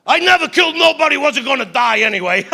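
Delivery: loud, fast tough-guy motto; hammed-up wiseguy boast; Uncle Cheesy. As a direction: bark it like a mob uncle. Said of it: "He had a motto".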